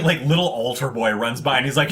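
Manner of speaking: ordinary talk, nothing marked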